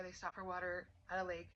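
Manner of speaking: usual speaking voice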